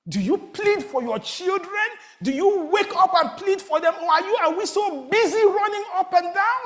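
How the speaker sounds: high, loud urgent preaching; preaching at full force. Acted. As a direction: press the congregation hard, rising. Preacher exhorting the congregation.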